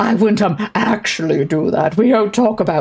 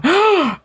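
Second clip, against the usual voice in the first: shocked gasp; 12 semitones higher than usual. Acted. Duchess character gasps in shock.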